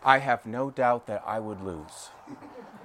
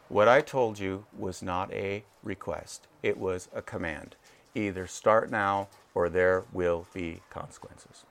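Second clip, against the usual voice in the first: low, stern, quiet commanding dad; hint of a stern father laying down the law; low warning voice of a father; 5 semitones lower, 4 dB quieter than usual. Shown as a demonstration. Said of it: "I use my dad voice".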